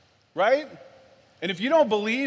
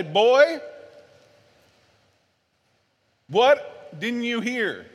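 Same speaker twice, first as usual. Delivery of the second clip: raised voice, slow stern demand; imitated angry dad; 2 semitones higher, 4 dB louder, much slower than usual. Dad pinning his son to the wall, imitated stern anger. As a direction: imitate a father laying down the law. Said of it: "He raised his voice".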